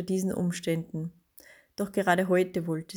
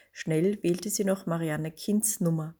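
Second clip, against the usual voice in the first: ordinary delivery, nothing marked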